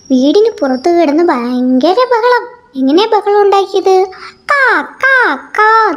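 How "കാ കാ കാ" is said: high, very slow drawn-out crow caw. Retold or imitated. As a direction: crow cawing for the children. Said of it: "എന്ന് പറഞ്ഞ്".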